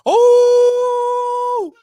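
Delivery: high, loud cheer; triumphant shout. Real, not acted. Streamer cheering his own rap, loud and high. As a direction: celebrate loudly.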